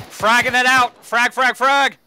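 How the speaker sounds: loud urgent callout; grenade warning yell. Real, not acted. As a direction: yell the warning to take cover. Throwing grenade, warning squad.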